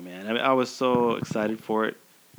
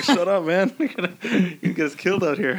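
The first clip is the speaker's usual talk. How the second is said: laughing protest; 7 semitones higher than usual. Retold or imitated. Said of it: "I was like".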